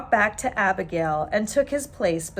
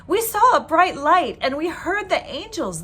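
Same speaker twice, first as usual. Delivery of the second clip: higher, louder, tumbling out the news; a rush of wonder in a raised voice; excited shepherds; 7 semitones higher, 4 dB louder than usual. Acted. Shepherds bursting in to tell the family, excited and loud. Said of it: "they were excited".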